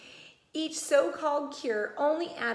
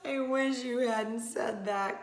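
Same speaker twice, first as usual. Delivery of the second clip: weary slowed groan; a touch of a groan from the girl; slower than usual. Acted. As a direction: sigh it out in dread. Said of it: "Camilla groaned".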